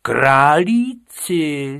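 loud, slow drawn-out word. Acted. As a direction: raise your voice in outrage. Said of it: "zvýšil hlas pan učitel".

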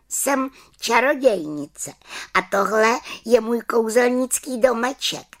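high, slowish witch reply; little witch voice. Acted. Little witch tells the children who she is, high voice. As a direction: announce proudly in a high witch voice.

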